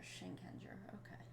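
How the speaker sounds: breathy whisper to herself; soft whispered aside. Real, not acted. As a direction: whisper it under your breath. Whispering to self.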